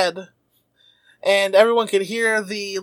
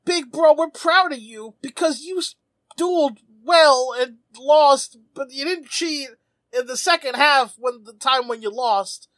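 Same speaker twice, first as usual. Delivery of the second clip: higher, halting little-brother voice; mock-earnest kid praising big brother; Rover brothers; 4 semitones higher than usual. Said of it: "being collectively eleven years old".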